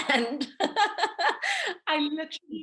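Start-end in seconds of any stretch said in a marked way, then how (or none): 0.5-1.8 a high, rueful laugh; rueful laughter. Real, not acted. Laughing at her own situation.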